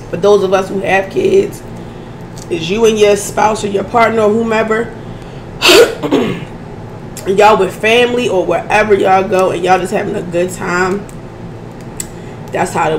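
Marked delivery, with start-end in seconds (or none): 4.9-7.2 throat clearing. Real, not acted. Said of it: "I'm sorry I keep doing that".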